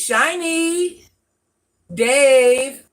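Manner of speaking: loud call of a name; loud greeting call. Real, not acted. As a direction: shout the name across the room. Calling out a viewer's name.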